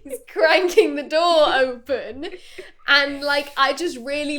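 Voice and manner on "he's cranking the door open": laughing through the words, louder; can barely get it out for laughing. Real, not acted. Cracking up at the scene.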